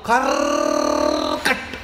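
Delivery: low, slow creaking lock sound; deep mechanical creak-click. Shown as a demonstration. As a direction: growl out the lock turning slowly. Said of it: "imitated lock click sound".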